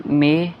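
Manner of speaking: "मे" with a held closed e; "मे" sounded slowly with closed ay; small e vowel. Shown as a demonstration. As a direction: stretch "मे" like may. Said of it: "छोटी ये".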